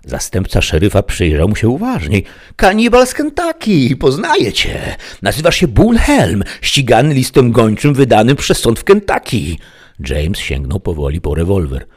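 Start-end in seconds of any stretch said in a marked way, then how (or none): 2.6-9.6 high, loud accusing voice; alarmed lawman. A narrator's reading. Deputy recognises the wanted cannibal, loud high challenge. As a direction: call out the outlaw, pitched way up.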